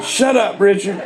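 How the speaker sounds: high mock snap; tossing off a fed-up shut up; exasperated retort. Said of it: "I said".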